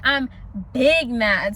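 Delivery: usual speaking voice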